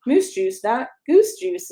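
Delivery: ordinary delivery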